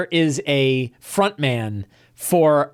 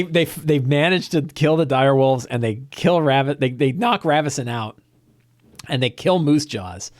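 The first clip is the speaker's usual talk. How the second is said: ordinary talk, nothing marked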